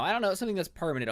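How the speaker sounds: normal talking voice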